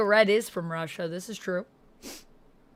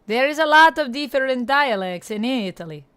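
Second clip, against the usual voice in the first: put-on effortful, playful Italian accent; amateur Italian accent, chatting to viewers. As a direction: explain it in an imitation Italian accent. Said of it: "hard for me to do an Italian dialect".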